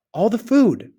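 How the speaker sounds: can hardly believe it; disbelief. A narrator's reading. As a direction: cook reporting the vanished food, stunned. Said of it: "said the cook in disbelief".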